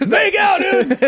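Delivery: very high, laughing cheer; cheering crowd. Retold or imitated. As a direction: whoop like a rowdy fan.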